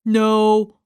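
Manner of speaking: plain reading voice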